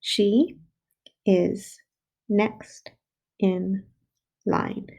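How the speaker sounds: each word set apart with pauses; teacher counting words aloud. Shown as a demonstration. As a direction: tap out She is next in line word by word. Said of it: "let's count out our words".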